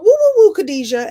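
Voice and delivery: very high, loud sitcom whoop; catchphrase holler. Retold or imitated. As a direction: belt out the catchphrase like an ad-lib on set. Re-enacts her sitcom catchphrase ad-lib.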